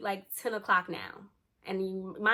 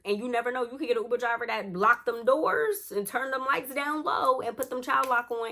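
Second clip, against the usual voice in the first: dramatised alarm; 4 semitones higher, 5 dB louder than usual. Retold or imitated. Imagining a kidnapping by driver.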